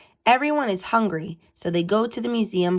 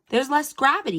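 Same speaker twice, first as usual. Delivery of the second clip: a keen, raised answer; mild yell; 7 semitones higher than usual. A narrator's reading. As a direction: blurt it out like a quiz winner. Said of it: "yells Edmund".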